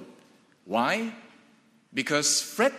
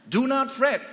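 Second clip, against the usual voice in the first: the preacher repeating the verse, high and emphatic; 9 semitones higher than usual. Real, not acted. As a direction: proclaim it high and strong. Repeats quote after laugh.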